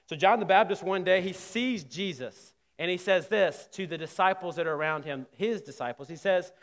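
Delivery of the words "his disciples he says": ordinary delivery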